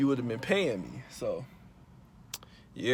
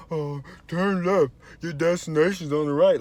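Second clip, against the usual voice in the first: mocking Siri; 5 semitones higher, 6 dB louder than usual. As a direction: spell out the directions like an annoying navigator. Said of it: "then Siri just wanna".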